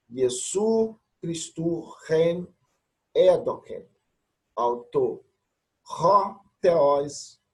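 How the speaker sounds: slow, careful Greek reading; slow reading aloud. Shown as a demonstration. Teacher reading Apocalypse verse for students, slow. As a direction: sound out the Greek word by word. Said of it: "teacher reading Greek verse slowly".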